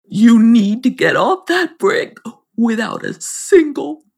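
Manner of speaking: a hint of a grave little creature; lightly voiced creature. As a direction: warn her in a slightly altered voice. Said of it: "And then he said".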